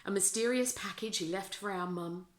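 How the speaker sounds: plain reading voice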